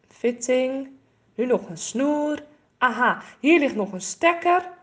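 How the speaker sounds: plain reading voice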